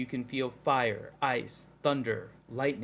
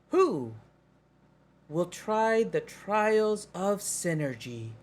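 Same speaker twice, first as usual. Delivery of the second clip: guardian voice; 6 semitones higher, slower than usual. Acted.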